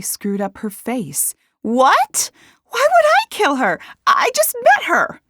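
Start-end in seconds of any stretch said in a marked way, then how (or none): 1.6-5.2 very high, loud disbelief; outraged denial. A narrator's reading. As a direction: protest innocence in a near shriek. Accused of murder, protests.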